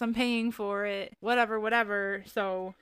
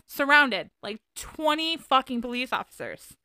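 emphatic; 5 semitones higher, 8 dB louder than usual. Real, not acted. Police surround her truck.

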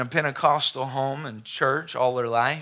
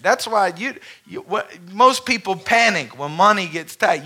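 preaching climax building louder and higher; building sermon; 6 semitones higher, 6 dB louder than usual. Acted. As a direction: build the exhortation to a peak. Preaching to the congregation.

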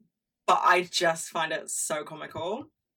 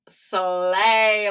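slay; 2 semitones higher, 9 dB louder than usual. Retold or imitated. Quoting own reaction to the couple.

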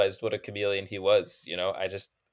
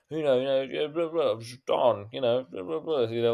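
rough, higher put-on gangster voice; hammy impression of a menacing thug; Ben Kingsley impression; 4 semitones higher than usual. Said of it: "those are the voices".

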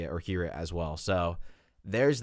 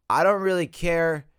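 higher and louder, slowed; emphatic; 7 semitones higher, 7 dB louder than usual. Real, not acted. Host repeating her rant, higher and louder. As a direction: stretch it out, fed up. Ranting again, stronger.

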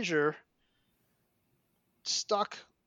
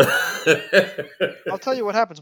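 laughing; 3 semitones higher, 9 dB louder, slower than usual. Real, not acted. Gleeful setup of absurd story.

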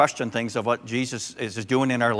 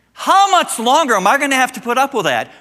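much higher and louder, fed up; exasperated outcry; 12 semitones higher, 9 dB louder than usual. Retold or imitated. Grandpa venting to himself about the toddler, high and loud. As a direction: cry it out in mock despair.

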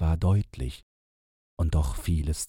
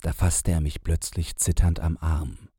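usual speaking voice

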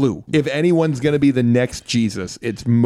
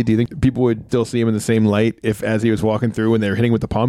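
normal talking voice

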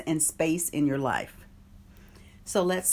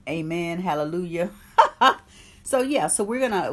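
ordinary delivery

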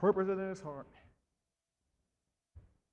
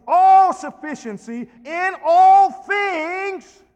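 loud, slow, high proclamation; full-force preaching; 3 semitones higher, 10 dB louder, slower than usual. Real, not acted. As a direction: preach it out to the church.

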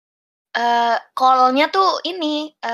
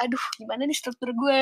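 downcast worry; 9 dB quieter than usual. Retold or imitated. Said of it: "Yang tadinya gue udah kayak down gitu".

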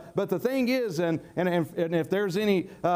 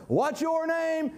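rousing call; 7 semitones higher, slower than usual. Acted. Preacher quizzes the congregation.

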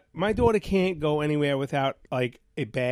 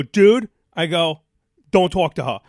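loud, indignant retold challenge; retold confrontation; 9 dB louder than usual. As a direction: call the usher out, loudly.

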